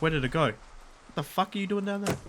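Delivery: usual speaking voice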